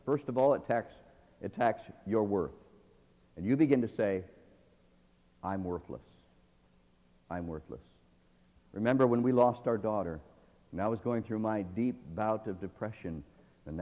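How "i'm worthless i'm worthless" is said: low despairing. Retold or imitated. Voicing a depressed thought.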